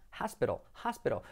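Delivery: "hospital" as HAS-pero; soft Spanish r for the t in "hospital"; pronunciación correcta. Shown as a demonstration. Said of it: "Como áspero". ("hospital" como «jáspero»)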